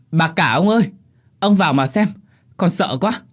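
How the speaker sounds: high, urgent servant's plea; frightened pleading. Acted. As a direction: beg the master to come, scared. Frightened servant begs master to come. (van nài sợ hãi, giọng cao)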